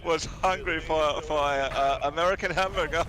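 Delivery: put-on giggly, naive German accent with Danish lilt; German accent, Danish flavor. Describing a viral cat picture, German-Danish accent. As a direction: play a German tickled by the internet, accent thick. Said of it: "a straight German without a little Danish flavor".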